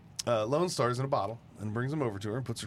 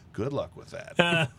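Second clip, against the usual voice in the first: slow, laughing quote of the bartender; laughing through it; 5 semitones higher, slower than usual. Retold or imitated. Telling a friend the bartender's punchline, slow and laughing. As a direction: chuckle out the bartender's line, slowly.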